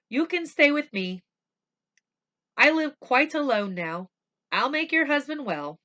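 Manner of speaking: high, loud, generous offer; kind doctor. Acted. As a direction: welcome them in eagerly. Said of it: "the doctor said".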